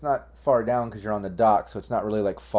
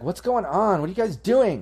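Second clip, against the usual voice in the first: high, sing-song busybody yell; yelling bystander; 10 semitones higher, 5 dB louder than usual. Acted. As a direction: call over in a high, indignant voice. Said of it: "that guy yelling".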